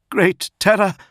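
high, loud cry of terror; alarmed cry. A narrator's reading. Prato starts up at the table, crying out in alarm. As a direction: cry out in sudden dread. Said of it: "he cried".

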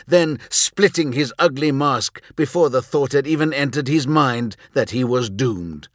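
ordinary delivery, nothing marked

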